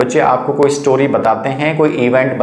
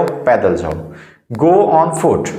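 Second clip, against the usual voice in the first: ordinary talk, nothing marked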